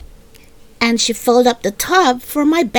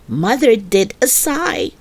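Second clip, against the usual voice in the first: ordinary delivery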